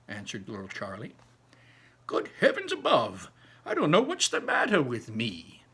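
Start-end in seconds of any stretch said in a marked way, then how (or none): 2.1-5.6 put-on high, loud old man's exclamation; Grandpa Joe exclaiming. Grandpa Joe shocked he never told Charlie, high exclamation. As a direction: throw up the hands in an old man's dismay.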